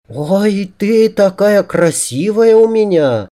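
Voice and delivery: high, drawn-out female cooing; doting woman. A narrator's reading. She admires her handiwork on him, high and gushing. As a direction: coo in a high, adoring voice. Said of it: "Она умилилась своему труду".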